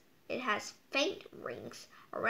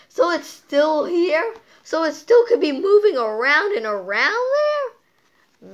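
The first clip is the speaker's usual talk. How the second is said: louder, rising with amazement; getting more and more worked up; excited; 5 semitones higher, 12 dB louder than usual. Real, not acted. Kid amazed the storm still rages, loud and excited.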